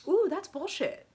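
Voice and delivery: high-pitched "I see through you" call-out; mock intuitive alarm. Intuitive person hearing someone lie, high mock alarm. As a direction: pitch it way up, playfully sensing a lie. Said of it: "you're like".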